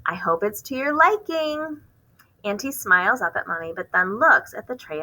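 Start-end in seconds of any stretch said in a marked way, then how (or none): 0.0-1.8 high, slow sing-song sweetness; sweetly hide the annoyance; mother masking irritation with a sing-song voice. Acted. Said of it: "high sing-song voice she uses".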